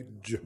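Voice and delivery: ج, a soft English J said once; J sound demo. Shown as a demonstration. Teacher repeating the letter sound for pupils. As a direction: model ج as the English J. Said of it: "ننطقه ازاي؟".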